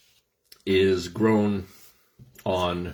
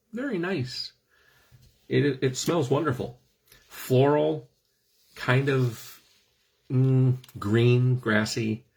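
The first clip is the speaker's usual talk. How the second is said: swooning; 6 semitones higher than usual. Real, not acted. Swooning over wife's perfume.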